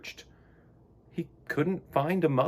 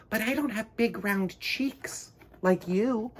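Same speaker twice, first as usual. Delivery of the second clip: penguin voice; 7 semitones higher than usual. Acted. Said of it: "sighed Missus Penguin".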